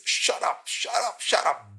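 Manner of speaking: hushing "Shut up" repeated; mock hushing crowd. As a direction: hiss the crowd's "shut up" to silence him. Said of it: "all that he gets back from people is".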